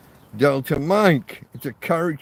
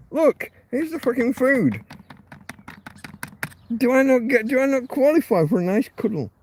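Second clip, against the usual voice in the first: much higher mock-hurt pleading with the dog, louder on really; indignant pet talk; 9 semitones higher, 3 dB louder than usual. Real, not acted. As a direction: complain to the dog he feeds it. Indignant at dog ignoring him.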